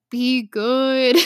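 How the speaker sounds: lightly voiced alien farewell; a hint of a movie creature's parting words. Fan quoting the dying giant's farewell, light E.T. imitation. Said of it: "Like E T".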